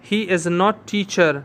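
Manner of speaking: he is not teacher, stretched out slowly; slow dictation. Shown as a demonstration. Teacher dictating the negative sentence to students, slow. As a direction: give each word its own beat. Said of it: "no के लिए push".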